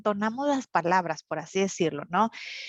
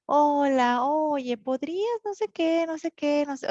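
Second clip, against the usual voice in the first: sweet, tender southern speaker; 7 semitones higher than usual. Retold or imitated. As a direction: play up a gentle, polite ask, a bit sugary. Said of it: "muy sweet". (imitación ligera de habla dulce y tierna, más aguda)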